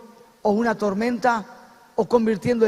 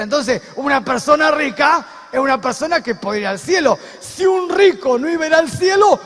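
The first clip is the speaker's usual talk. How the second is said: loud, higher-pitched preaching shout; full-force preaching; 4 semitones higher, 9 dB louder than usual. Real, not acted. Preacher proclaiming that all is possible for God, impassioned shout.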